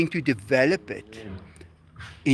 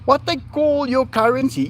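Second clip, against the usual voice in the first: usual speaking voice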